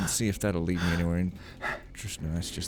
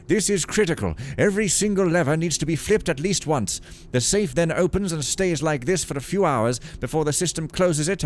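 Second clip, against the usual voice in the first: raised, formal priestly letter voice; old priest reading letter; 5 semitones higher than usual. Acted. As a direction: read it like a pious old priest.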